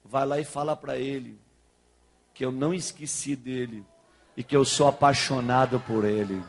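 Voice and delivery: low, measured delivery of a message from God; a hint of God speaking, low and unhurried; God's voice, lightly voiced. God sending the pastor with a message of love, lightly voiced low and solemn. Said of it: "Deus falou assim".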